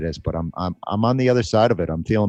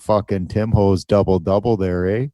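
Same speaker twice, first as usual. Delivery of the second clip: put-on dubious Canadian accent; 3 semitones higher, 4 dB louder than usual. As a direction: lay the fake Canadian accent on thick. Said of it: "not even a Canadian accent".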